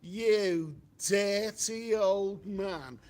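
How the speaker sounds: loud, very slow, drawn-out mock outburst; put-upon son blasting his old dad; imitated Harold Steptoe insult. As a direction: bellow it slowly like Harold Steptoe. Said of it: "would say".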